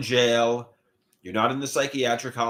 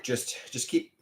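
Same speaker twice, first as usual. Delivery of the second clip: usual speaking voice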